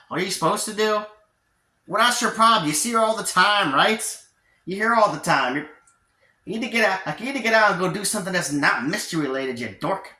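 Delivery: high-pitched, impatient mocking echo; exasperated scolding. Pacifica scolds, echoing his question.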